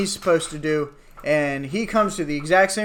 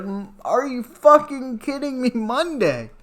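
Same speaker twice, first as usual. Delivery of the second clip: higher, incredulous outburst; hammed-up disbelief at the date; retold fury, higher; mock outrage; 8 semitones higher, slower than usual. Outraged reaction.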